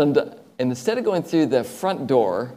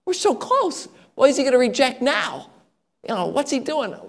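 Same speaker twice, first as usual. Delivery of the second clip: much higher, fretting inner voice; anxious disbelief; 12 semitones higher than usual. Retold or imitated. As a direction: panic rising as the deal slips away.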